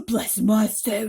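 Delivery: loud slow exclaim; startled old blessing. A narrator's reading. Grasshopper exclaiming to the others in alarm. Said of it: "the old green grasshopper exclaimed".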